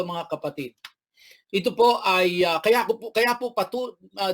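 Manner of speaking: normal talking voice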